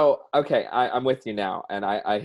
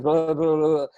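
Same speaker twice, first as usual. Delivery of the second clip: rapid mock babble, pitched up; babbling fast talker; 5 semitones higher, 4 dB louder than usual. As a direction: imitate someone who can't stop talking. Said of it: "he talks so fast".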